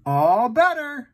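high, slow soothing all better. Acted. Uncle reassuring the hurt monkey, high and slow. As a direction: coo it like comforting a child.

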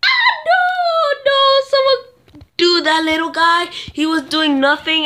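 very high loud protest; real indignant yell; high loud complaint settling down; outraged shriek. Real, not acted.

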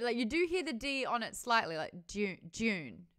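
usual speaking voice

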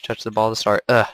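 ordinary talk

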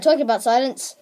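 usual speaking voice

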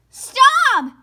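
high, loud shout to stop; loud plea. Acted. Sister shouting at Brother to stop the wailing. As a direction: yell with fingers in ears. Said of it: "said Sister, putting her fingers in her ears".

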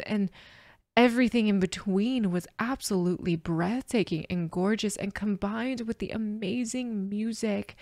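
ordinary talk

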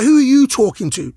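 squaring up, mildly acted; retold confrontation. Player answering back to the manager, confrontational. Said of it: "I was like".

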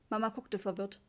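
ordinary delivery, nothing marked

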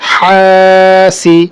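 slow, level, sustained first vowel in "হাসি"; stretched reading. Shown as a demonstration. Teacher reading through for students, "হা" drawn out. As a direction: hold "হা" long in "হাসি", then short "সি". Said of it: "টানা পড়ে চলে যাবো".

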